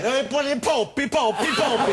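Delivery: high, loud, laughing mock Italian; bad Italian accent. Host retrying a jokey fake Italian accent. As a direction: ham up an awful Italian accent through laughter. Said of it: "Let's try it again".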